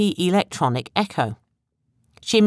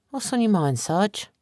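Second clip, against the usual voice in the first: curious; 5 semitones higher than usual. A narrator's reading. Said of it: "Betsy asked curiously".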